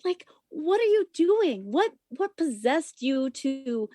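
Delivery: high, outraged demand; furious confrontation. Retold or imitated. Stripper yelling at a customer who grabbed her head. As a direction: let the indignation burst out.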